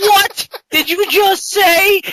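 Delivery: high, loud, drawn-out disbelief; stunned yell, stretching the words; incredulous outburst. Retold or imitated. Friend reacts to the paladin's crude name, loudly incredulous.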